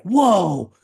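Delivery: big retold whoa of delight; imitating excited kid. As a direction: mimic an excited kid's amazement. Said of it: "He was all excited".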